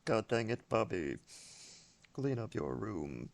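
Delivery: low, quiet cartoon-dad scolding; Hank Hill. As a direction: grumble it low and soft at the kid, slowing at the end. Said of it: "more Hank Hill quotes".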